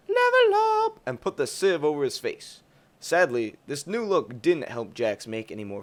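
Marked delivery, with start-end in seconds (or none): 0.1-1.0 very high, loud cartoon cry; hype game shout. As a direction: yell it like a triumphant video game character. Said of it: "Jax was all like".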